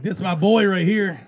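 high loud bro greeting. Retold or imitated. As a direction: boast like a proud buddy. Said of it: "He'd be like".

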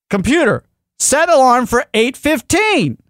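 high, slow, over-deliberate order; exasperated command. Retold or imitated. Frustrated man repeating the alarm order, exasperated command.

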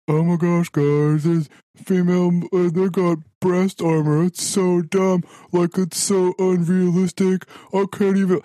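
high whiny mocking voice; mocking internet complainer. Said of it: "all I've seen on the internet".

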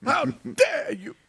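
high, tearful mock Greta; Greta rant. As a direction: wail it like a tearful outraged teen. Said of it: "Greta impression again".

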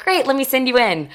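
imitated chirpy, high-pitched receptionist; receptionist's voice. Receptionist sending a finalist in, high and chirpy. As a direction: send them through with a lifted, high voice.

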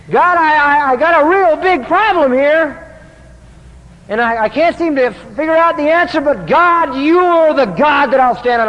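a hint of a desperate psalmist; pleading prayer. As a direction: cry out to God as a troubled man. David's prayer voiced in distress.

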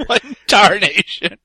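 laughing through the words; amused, laughing quote. Real, not acted. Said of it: "laughing repeat of the line".

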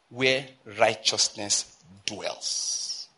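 "where righteousness dwells" drawn out slowly, word by word; emphatic phrase. Shown as a demonstration. Preacher reading scripture to the congregation, "where righteousness dwells" slowly emphasized. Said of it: "I read that to emphasize".

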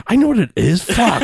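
high-pitched, comic exasperation; exasperated outburst. Acted. FBI head reacts to the bomb explanation, mock exasperation. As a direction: act fed up with being told the obvious. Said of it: "the best reaction".

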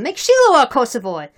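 loud, forceful nomination; shouted. A narrator's reading. Some Cossacks proposing a new leader, shouted. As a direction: call it out to the assembly. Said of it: "shouted some".

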